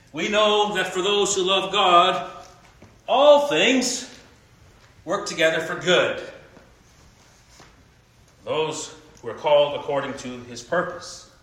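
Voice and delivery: slow, emphatic Bible reading with a high peak on all things; preacher reading Romans aloud; scripture reading. Acted. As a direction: read the verse as a promise, lifting all things.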